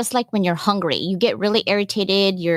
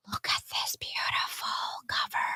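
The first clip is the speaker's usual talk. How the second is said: soft breathy whisper to show off the cover; whisper for effect; 13 dB quieter than usual. Acted. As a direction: hush it like revealing a secret. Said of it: "I know I'm whispering, huh? For effect".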